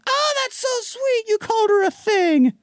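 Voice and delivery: high, gushing mom voice; doting mother. Retold or imitated. Mother asking son about his new girl, high and gushing. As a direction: coo it like an eager mother.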